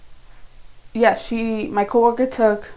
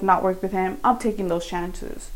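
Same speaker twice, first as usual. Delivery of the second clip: ordinary talk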